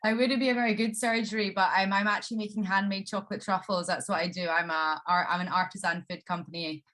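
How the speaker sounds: normal talking voice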